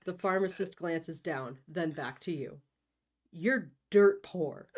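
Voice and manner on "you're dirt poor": slow, louder, smug put-down; a drawn-out obnoxious jab. Acted. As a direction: rub his nose in it. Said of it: "in a way that I am struggling not to call obnoxious".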